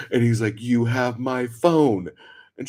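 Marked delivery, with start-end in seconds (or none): 0.6-2.1 slow, drawn-out, louder and higher; mock yell, held back from a scream. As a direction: imitate an exasperated man calling up. Man yelling back up at her.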